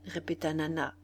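plain reading voice

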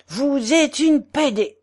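put-on German-accented insult. German friend rebuking the portress, slow German-accented insult.

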